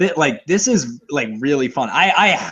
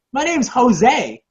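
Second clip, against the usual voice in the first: high, slow imitation of a child; kid's voice; 9 semitones higher, much slower than usual. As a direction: draw it out like a little kid. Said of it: "And the kid's like".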